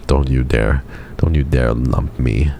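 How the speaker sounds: ordinary talk, nothing marked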